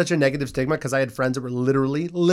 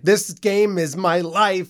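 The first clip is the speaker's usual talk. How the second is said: slow, drawn-out mock gravity; mock serious gamer; 4 semitones higher, much slower than usual. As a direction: parody someone too invested, very slowly. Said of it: "Life's too short to be like".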